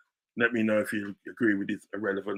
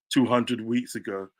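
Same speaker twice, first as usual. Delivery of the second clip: ordinary talk, nothing marked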